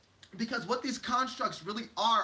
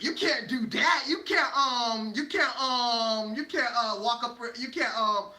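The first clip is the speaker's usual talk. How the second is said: louder, slow, stretched refusals; mock objecting; 3 semitones higher, 5 dB louder, slower than usual. Society stopping someone at the next light, mock objecting. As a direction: draw out each objection, fumbling for a reason. Acting society blocking you at stoplight.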